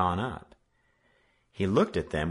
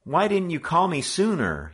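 growling; 10 semitones higher, 5 dB louder than usual. A narrator's reading. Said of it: "he growled".

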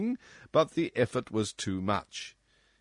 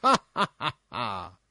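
king's laugh; 6 dB louder than usual. Acted. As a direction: laugh at your own bad joke. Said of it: "his laugh was chorused".

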